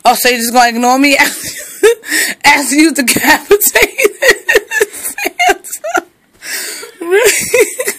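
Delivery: scolding through laughter; laughing scold. Real, not acted. Owner laughing as she scolds the dog. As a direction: scold while cracking up.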